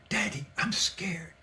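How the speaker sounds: put-on small, soft voice of a frightened child; imitated scared little boy. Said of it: "I heard the little boy say".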